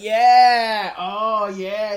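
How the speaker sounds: loud, high, drawn-out cheering yeah; excited cheer. Retold or imitated. Movie fan re-enacting the audience's reaction to a stabbing, excited cheer. Acts out viewers cheering the stab.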